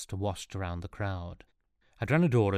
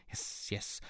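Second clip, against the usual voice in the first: whispered aside; 11 dB quieter than usual. Acted. Impatient advisor brushes him aside.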